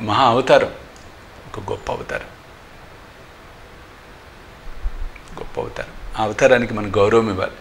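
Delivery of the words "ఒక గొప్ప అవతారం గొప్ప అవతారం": reverent whisper. Real, not acted. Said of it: "reverent repetition".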